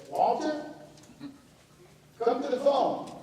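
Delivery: retold aunt summoning Walter; aunt calling out. Aunt calling uncle to phone.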